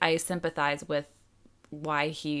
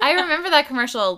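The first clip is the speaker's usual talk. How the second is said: excited; 9 semitones higher, 10 dB louder than usual. Real, not acted. Excited recognition of an ad.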